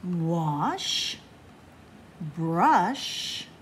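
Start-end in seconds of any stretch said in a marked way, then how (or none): none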